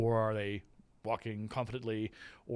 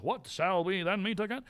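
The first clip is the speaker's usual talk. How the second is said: a hint of a witch, higher and louder; first witch line; 7 semitones higher, 5 dB louder than usual. Teacher quotes the first witch's line, lightly voiced. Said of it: "the first witch saying her lines".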